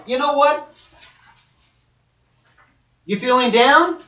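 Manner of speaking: usual speaking voice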